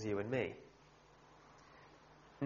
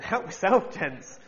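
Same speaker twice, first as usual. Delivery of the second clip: mock polite surrender; 7 semitones higher, 6 dB louder, slower than usual.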